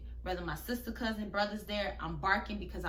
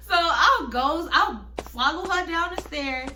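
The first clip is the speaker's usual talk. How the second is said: laughing while talking; 7 semitones higher, 10 dB louder than usual. Real, not acted.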